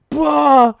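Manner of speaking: high, loud imitated grunt of a kid hit in the groin; sudden hurt yelp, hammed-up; mock pained grunt. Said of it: "And he goes".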